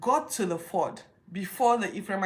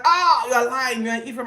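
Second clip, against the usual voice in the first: angry accusation; 5 semitones higher, 10 dB louder than usual. Retold or imitated. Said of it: "So they're like".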